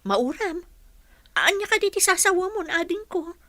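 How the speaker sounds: high, loud alarmed questions; shocked, pressing her little brother. Acted. Sister alarmed at brother's words. (nabiglang tanong sa kapatid)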